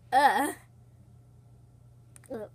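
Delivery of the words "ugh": ordinary delivery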